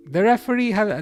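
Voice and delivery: normal talking voice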